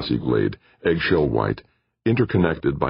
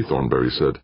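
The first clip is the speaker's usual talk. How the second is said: plain reading voice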